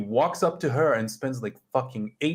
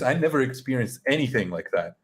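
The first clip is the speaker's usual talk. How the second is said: ordinary talk, nothing marked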